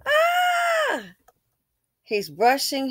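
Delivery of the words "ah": high, drawn-out mock scream; imitated holler. Vlogger mimicking the man in the commercial, mock holler. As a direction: mimic his long high holler without extra volume. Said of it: "his part is to holler".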